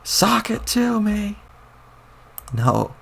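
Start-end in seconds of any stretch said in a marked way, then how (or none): 0.0-1.4 put-on high, very slow old-man catchphrase; slow, high folksy exclamation; old-timer exclamation. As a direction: stretch it out like a gleeful old geezer. Old grandpa exclaims while powering up.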